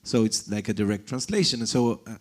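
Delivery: ordinary talk, nothing marked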